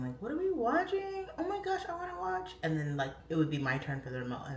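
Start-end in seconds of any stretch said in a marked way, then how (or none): 0.2-2.6 high-pitched mock childish protest; whiny kid voice, pitched way up; begrudging little girl. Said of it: "I was, like, begrudging".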